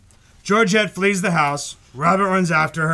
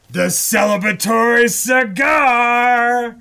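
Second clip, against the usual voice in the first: grand announcement; 5 semitones higher, 3 dB louder, slower than usual. Real, not acted. Proclaiming the cigar.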